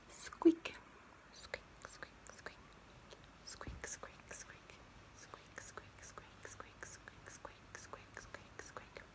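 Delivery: dolphin squeaks. Shown as a demonstration. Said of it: "I can whistle".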